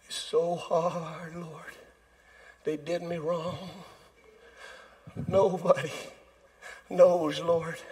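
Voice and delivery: soft, slow, mournful mock complaint to the Lord; hushed woe-is-me whine; mock lament. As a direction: moan it like a self-pitying sad song. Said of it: "I can sing "Woe is me".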